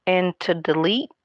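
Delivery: ordinary talk, nothing marked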